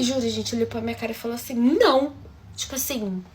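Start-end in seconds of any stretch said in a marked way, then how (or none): 1.5-2.1 a loud, curt no; blunt no. Retold or imitated. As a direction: cut her off flatly. The boy's curt reply.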